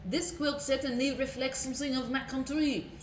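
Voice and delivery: lightly voiced French quilt maker; a hint of a proud French maker. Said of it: "says the quilt maker".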